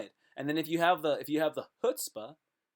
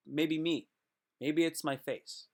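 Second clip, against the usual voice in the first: ordinary talk, nothing marked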